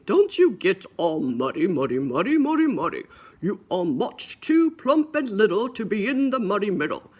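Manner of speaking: playful stern dad voice; scolding daddy pig. Acted. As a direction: wag a finger at the muddy piggy.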